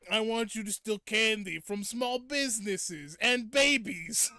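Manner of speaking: slow, loud, high devil voice; comic devil. Retold or imitated. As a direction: command it grandly as a silly demon. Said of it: "the voice for it is so funny".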